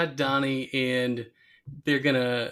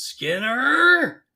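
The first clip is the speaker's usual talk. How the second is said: higher, louder worried call; Chalmers calling; 12 semitones higher, 8 dB louder than usual. Retold or imitated. Chalmers calling into the rubble for Skinner, alarmed. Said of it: "where he goes".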